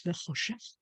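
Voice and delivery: choshech breathed out soft and low, ch like breathing; breathy choshech. Shown as a demonstration. Teacher showing class the breathing sound in choshech. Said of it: "a little bit like breathing again".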